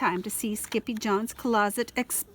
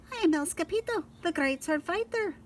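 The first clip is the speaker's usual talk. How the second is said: Scapito character voice; 8 semitones higher than usual. A narrator's reading.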